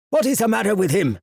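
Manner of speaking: sharp, high demanding question; scowling. Acted. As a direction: scowl as you ask.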